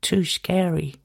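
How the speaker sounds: high, soft little schoolchild voice; school friend. Retold or imitated. School friend explaining why mum forbade it, imitated child voice. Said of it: "only for her to tell me".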